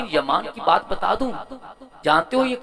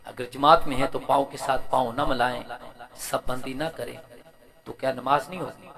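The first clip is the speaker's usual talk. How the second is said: low, soft voice with pauses; subdued low-key questioning; hushed preacher's retelling; 5 semitones lower, 7 dB quieter than usual. Retold or imitated. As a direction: put the questioner's query quietly, item by item. Retells people's question softly.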